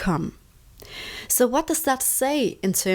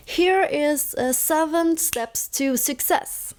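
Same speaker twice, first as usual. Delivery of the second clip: lightly imitated presenter, higher pitch, slowing at the end; speaker talking from the head; 6 semitones higher, slower than usual. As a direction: present it like a detached slideshow speaker. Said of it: "speakers who talk from the head".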